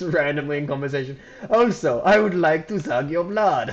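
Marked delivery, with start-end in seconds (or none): 1.5-3.7 louder, higher vampire voice. Retold or imitated. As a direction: ham up a creepy vampire. Customer reveals vampire wish.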